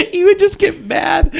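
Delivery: high, laughing while talking; cracking up mid-story. Real, not acted. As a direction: squeeze the words out through real laughter. Laughing while telling coworker's outbursts.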